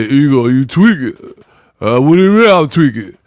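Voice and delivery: mock garbled back-and-forth; put-on babble voice. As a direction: play dumb in an exaggerated put-on voice. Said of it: "I don't talk like that for real".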